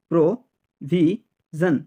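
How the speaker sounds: Provision slowly as pro-vi-zon; syllable by syllable. Shown as a demonstration. Teacher drilling learners, provision in slow separate syllables. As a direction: pause after each syllable of provision.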